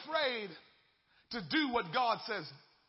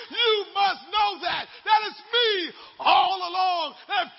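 loud rising preacher cadence, higher and louder; preaching at full force; 4 semitones higher, 5 dB louder than usual. Acted. Pastor preaching to the church, building to a shout. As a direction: build the congregation up, preaching hard.

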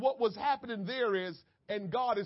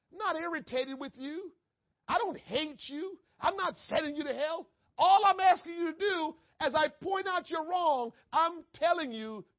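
higher and louder, building to an urgent appeal; God pleading; 7 semitones higher, 5 dB louder than usual. Acted. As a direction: lift it and plead with the whole congregation. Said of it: "That's all God is saying".

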